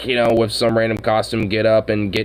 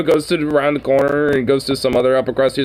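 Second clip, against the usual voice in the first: ordinary talk, nothing marked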